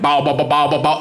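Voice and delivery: rapid bouncing "bow-bow" babble; baby-soothing noise. Retold or imitated. Comedian getting crying baby to laugh, silly noise. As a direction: make a silly repeated noise to amuse a baby. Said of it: "So I do that noise".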